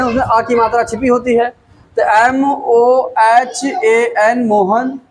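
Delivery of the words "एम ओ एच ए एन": एम ओ एच ए एन slowly, letter by letter; slow, separated letters, एम, ओ, एच, ए, एन; spelling out. Shown as a demonstration. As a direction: spell एम ओ एच ए एन with a beat on each letter. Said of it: "spelling बना लेंगे".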